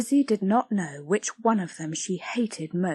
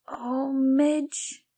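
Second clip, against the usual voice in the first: silent cry; 6 semitones higher than usual. Acted. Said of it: "cried Lizzie silently".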